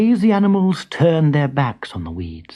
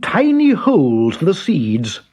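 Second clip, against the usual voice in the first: lion leader directing the animals; Lion's voice; 6 semitones higher than usual. A narrator's reading. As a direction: announce the next job as Lion.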